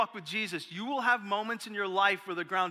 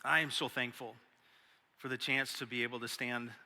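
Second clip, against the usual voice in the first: prayer; 6 semitones lower, 6 dB quieter than usual. Acted. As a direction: pray quietly to God. Begins prayer.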